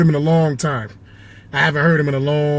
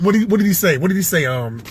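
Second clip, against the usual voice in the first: ordinary talk, nothing marked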